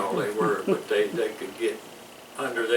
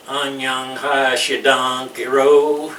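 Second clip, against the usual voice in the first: slow, rolled-out Cholado Korean greeting, said louder; Cholado Korean accent; 4 semitones higher, 10 dB louder, slower than usual. Retold or imitated. Showing how Cholado locals greet people, in a slow, rolled Cholado Korean accent. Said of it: "they roll it out and have a, a funny accent".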